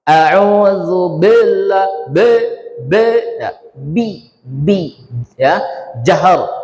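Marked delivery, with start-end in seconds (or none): 0.0-2.1 slow "A'udzu billah" with a heavy "be"; wrong reading. Shown as a demonstration. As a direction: model the error, drawn out. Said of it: "jangan dibaca tebal".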